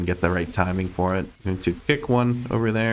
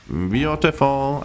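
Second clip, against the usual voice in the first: slow, drawn-out, higher pleased beautiful; pleased drawl; 6 semitones higher, much slower than usual. Real, not acted. Gamer savouring a clean move, slow pleased drawl. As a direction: savour the word, stretched slowly.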